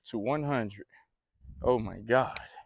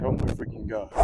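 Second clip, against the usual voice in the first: whispered disbelief; 5 dB quieter than usual. Real, not acted. Stunned after landing big bass.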